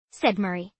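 usual speaking voice

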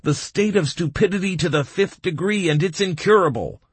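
high, exasperated outburst; emphatic interruption. A narrator's reading. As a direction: declare a mock diagnosis emphatically. Baum interrupts with mock diagnosis.

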